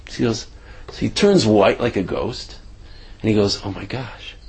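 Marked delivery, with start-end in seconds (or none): 3.6-4.3 soft stunned dread; hushed horror. Retold or imitated. Pharmacist quietly horrified at his mistake. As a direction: breathe it out in shock.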